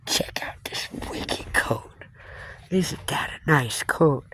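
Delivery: slow, hushed whisper; whispered show-off. Real, not acted. Showing viewers the coat the old man gave, slow whisper. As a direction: whisper it like a secret treasure.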